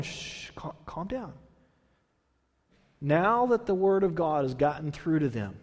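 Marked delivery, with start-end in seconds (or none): 0.0-1.3 whispered shush, soft calm down; hushing. Retold or imitated. Priests going around calming people, whispered hush. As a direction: hush a weeping crowd. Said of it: "priests are going around going".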